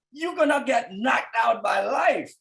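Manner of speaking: ordinary talk, nothing marked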